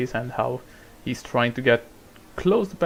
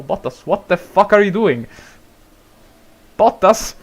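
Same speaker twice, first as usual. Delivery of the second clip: shrieking much higher and louder; furious screaming; 8 semitones higher, 11 dB louder than usual. Real, not acted. Commentator screaming at Bottas as he goes off, shrill and loud. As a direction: scream in disbelief at the wreck. Driver crashes, streamer erupts.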